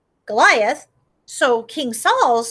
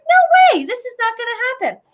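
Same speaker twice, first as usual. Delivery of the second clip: much higher, loud outburst; indignant David; 11 semitones higher, 5 dB louder than usual. Acted. David refusing to let it go, high loud outburst. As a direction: burst out refusing. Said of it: "so he's like".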